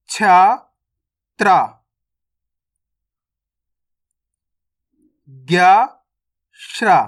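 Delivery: loud, isolated syllables with gaps to repeat; repeat-after-me alphabet drill. Shown as a demonstration. Teacher has learners repeat along, loud letter-by-letter drill. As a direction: call out each letter emphatically, then pause.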